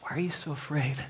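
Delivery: soft, hushed, tender question. Retold or imitated. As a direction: ask gently, like comforting a frightened friend. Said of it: "in a very tender way".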